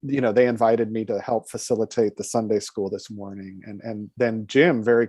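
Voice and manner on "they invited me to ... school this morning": ordinary delivery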